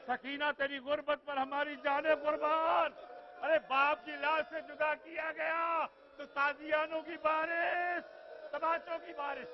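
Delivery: loud, slowed preacher's lament; full-voiced mourning wail. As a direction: cry out the grief to the mourners. Majlis preacher laments Sakina.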